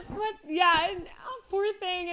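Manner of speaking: high voice still full of laughter; laughing through words. Real, not acted. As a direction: talk while recovering from a laughing fit. Still recovering from laughing fit.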